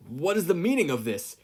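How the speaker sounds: loud, high alarmed demand; outraged captive demanding answers. A narrator's reading. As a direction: bark it out in shock. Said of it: "Yagi demanded".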